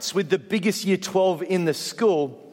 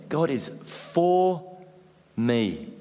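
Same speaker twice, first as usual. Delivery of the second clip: slow, leaning hard on "for"; stress on for; much slower than usual. Shown as a demonstration. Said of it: "putting the emphasis in different places".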